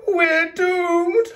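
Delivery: high, loud mock wail. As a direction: wail it like a doomed plastic bag. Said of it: "wailed a plastic bag".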